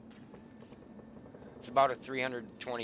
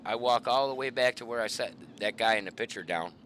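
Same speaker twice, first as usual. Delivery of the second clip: ordinary delivery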